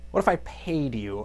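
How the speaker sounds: a quiet tempting offer; sly offer. Retold or imitated. Skeletor softly offering Triclops money.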